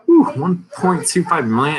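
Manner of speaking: amazed whew. Real, not acted. Reacting to seized money.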